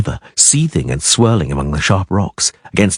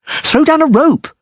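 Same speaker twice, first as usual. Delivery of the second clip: bellowed. A narrator's reading. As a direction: bellow it at the others. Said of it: "Dandelion bellowed".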